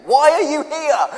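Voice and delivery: high, loud, slow shouted challenge; yelling. Retold or imitated. Camp leader yelling at arrivals, high and loud. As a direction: yell it down at a scared newcomer. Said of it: "'cause someone's yelling at you".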